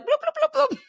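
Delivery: high squeaky bloop sounds; makeup bloop noises. Retold or imitated. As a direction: pop out little high bloops. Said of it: "I'd just be like".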